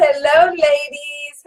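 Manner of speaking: ordinary talk, nothing marked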